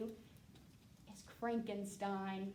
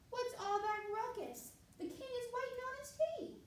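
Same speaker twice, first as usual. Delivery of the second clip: high princess voice; 11 semitones higher than usual. Acted.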